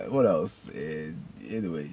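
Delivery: ordinary talk, nothing marked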